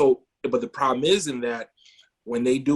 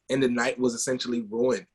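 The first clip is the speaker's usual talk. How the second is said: plain reading voice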